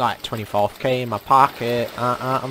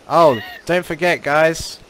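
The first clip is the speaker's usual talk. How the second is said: usual speaking voice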